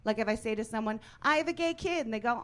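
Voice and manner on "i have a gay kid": high, bright proud announcement; chirpy announcement. Retold or imitated.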